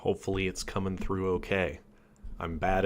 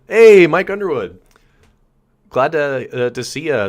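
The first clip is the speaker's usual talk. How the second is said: loud, much higher, delighted hello; excited greeting; 7 semitones higher, 13 dB louder than usual. Real, not acted. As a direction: call out happily to a friend who just arrived. Greeting a friend in chat.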